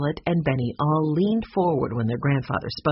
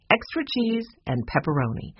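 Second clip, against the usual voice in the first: plain reading voice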